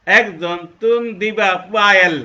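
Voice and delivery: loud Chakma-style line; Chakma speech. Shown as a demonstration. Teacher demonstrating Chakma speech, loudly. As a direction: call it out as a Chakma speaker would. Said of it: "চট্টগ্রামের চাকমারা বলবে".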